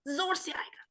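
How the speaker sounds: unvoiced whispered aside; stage whisper. The teacher whispers a tip to the children, whispered.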